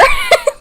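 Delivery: sudden squealing laugh, loud and high; high burst of laughter. Real, not acted. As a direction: let a shrill laugh burst out. Laughs at mother's reaction.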